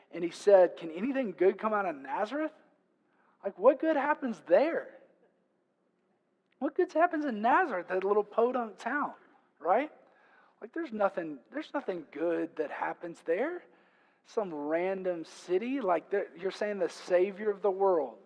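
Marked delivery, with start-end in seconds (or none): 0.8-2.5 incredulous sarcastic question with a rising end. Acted. Nathanael answering Philip about Nazareth, sarcastic. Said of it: "Nathanael had the spiritual gift". 3.4-18.2 high, swooping disbelief; mock the town with exaggerated incredulity; sarcastic. Nathanael scoffing at a little podunk town, sarcastic. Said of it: "an appropriate level of sarcasm".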